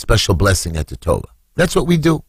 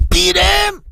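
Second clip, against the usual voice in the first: high-pitched yell, climbing higher; retold cry in a much higher voice; frantic shouting. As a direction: shout it out in a rising frenzy. Acting out the beating.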